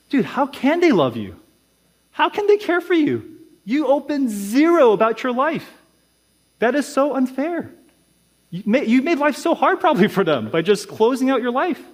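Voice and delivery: high-pitched, exasperated pushback; incredulous protest. Acted. Protests the imagined member's complaint.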